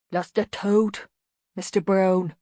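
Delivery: a hint of a slow big lout; lightly voiced lumbering simpleton echoing; thunder voice. A narrator's reading. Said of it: "said the thunder that was Banjo".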